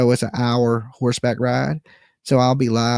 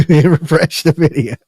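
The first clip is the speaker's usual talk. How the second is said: laughing, louder and higher; 4 semitones higher, 7 dB louder than usual. Real, not acted. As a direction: burst out laughing through it. Repeating the plea, laughing.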